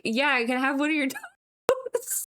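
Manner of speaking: request breaking up into real laughter; laughing. Real, not acted. Wife retelling her silly request to husband, laughing. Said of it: "I just kept laughing about it".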